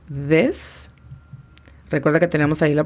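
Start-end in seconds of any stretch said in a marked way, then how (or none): 0.1-0.9 "This" drawn out with a rising end; phonetic demonstration. Shown as a demonstration. Teacher shows learners the TH word, phonetic demonstration. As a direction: say "This" slowly and carefully, lifting the end. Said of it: "La pronunciación fonética sería la siguiente".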